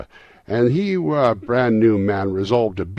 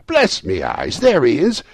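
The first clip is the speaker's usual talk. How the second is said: put-on amazed delight at spotting an old friend; excited recognition; 9 semitones higher, 7 dB louder than usual. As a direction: exclaim louder, leaping higher at the end. Scrooge excited to see Dick.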